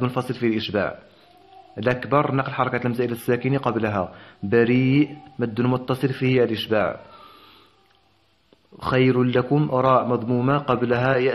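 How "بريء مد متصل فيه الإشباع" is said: usual speaking voice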